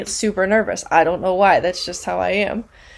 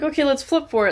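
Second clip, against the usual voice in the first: higher-pitched imitation of panicked friends; mock panic; 6 semitones higher than usual. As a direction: act out a mildly panicked suggestion. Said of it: "they're, like, panicking".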